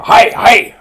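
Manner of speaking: high, loud mock-Japanese chorus; Japanese pilots' shout. Pilots answering their admiral, high mock-Japanese shout. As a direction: shout it like obedient recruits. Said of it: "these guys all go".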